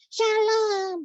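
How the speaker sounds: put-on squeaky child puppet voice, confused; high puppet voice. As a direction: play the little puppet mishearing. Puppet voice of Mulia.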